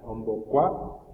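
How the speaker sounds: slow, clear "Ombocua"; slow model word. Shown as a demonstration. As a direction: sound out "Ombocua" syllable by syllable. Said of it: "M, B va a ir acá". ("Ombocua" despacio, sílaba por sílaba)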